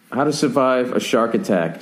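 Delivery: ordinary talk, nothing marked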